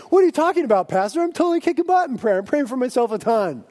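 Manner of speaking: high, loud, quick mock protest; cocky objection. Boastful churchgoer answering the pastor, cocky objection.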